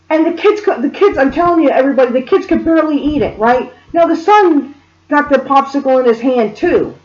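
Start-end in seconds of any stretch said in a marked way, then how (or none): none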